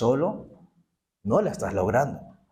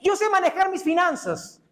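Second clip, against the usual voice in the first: higher, laughing mock boast; boastful spender; 10 semitones higher than usual. Preacher mocks a debtor boasting about his finances, high and laughing. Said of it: "Es esa persona que dicen".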